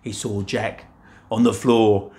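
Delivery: usual speaking voice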